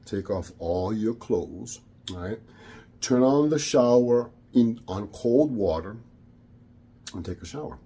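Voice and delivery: low, quiet, menacing command; scary inner voice. Retold or imitated. Dark voice ordering Luis into cold shower, low menace. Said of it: "a, a scary voice".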